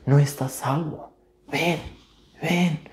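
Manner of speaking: softly beckon, never shouting; calm quiet voice. Retold or imitated. God calling the unsaved sinner, quiet and gentle. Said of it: "con una voz tranquila, no de gritar".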